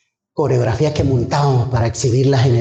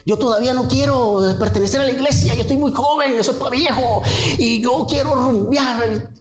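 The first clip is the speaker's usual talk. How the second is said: retold crying complaint; 10 semitones higher than usual. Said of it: "llorando y peleando con Dios".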